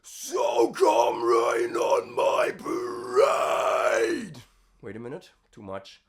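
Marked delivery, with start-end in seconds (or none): 0.0-4.4 low, tight compressed scream, lower; low hyper compressed scream. Shown as a demonstration. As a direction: squeeze it tight in the throat, low. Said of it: "this will be a low version of it".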